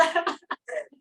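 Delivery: short breathy laugh; a light airy chuckle; quick laugh. Real, not acted. Brief laugh mid-talk.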